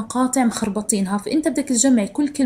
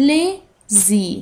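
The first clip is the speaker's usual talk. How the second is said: "Lazy" slowly as la, pause, zy; two even, separate beats in "Lazy"; syllable by syllable; much slower than usual. Shown as a demonstration. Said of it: "Two syllables". (مقطعين منفصلين ببطء)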